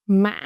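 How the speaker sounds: "Mã" with a break, then a rise; broken rising tone. Shown as a demonstration. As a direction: break "Mã" mid-vowel and lift it. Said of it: "is broken raising".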